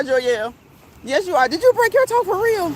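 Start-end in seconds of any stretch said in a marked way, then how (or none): 1.5-2.7 surprised. Real, not acted. Asking about a broken toe again.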